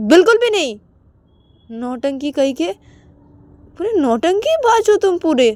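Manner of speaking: high loud scold then flustered stammer; scold, then fumble for excuse. Acted. Payal scolding Ananya for his drama.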